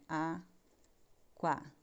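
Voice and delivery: "Aquarela" drawn out in slow syllables. Shown as a demonstration. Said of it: "pode ficar um pouquinho pra fora da linha". ("Aquarela" separada em sílabas, devagar)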